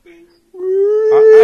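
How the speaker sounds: high, loud, long held whale cry; whale song. Pretend. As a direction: wail it out like a singing whale. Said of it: "there's your whale, everyone".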